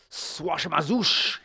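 drawn-out, loud magic word; high, slow wizard's incantation; wizard's cry. A narrator's reading. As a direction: cry out the incantation, stretching it slowly. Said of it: "he cried".

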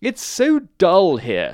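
slow, high, loud disdain; bored Town Mouse griping; rude complaint. A narrator's reading. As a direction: drag it out scornfully. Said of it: "said rudely".

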